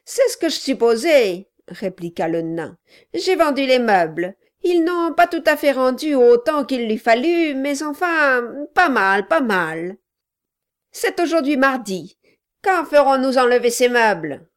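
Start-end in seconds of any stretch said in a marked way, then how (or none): 0.1-1.5 loud, higher dwarf voice; a loud, pushy little man; gruff gravelly dwarf voice. Acted. Quilp speaks. 3.2-14.5 loud, higher dwarf voice; a loud, pushy little man; gruff gravelly dwarf voice. Acted. Quilp speaks.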